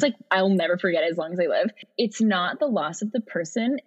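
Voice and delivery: usual speaking voice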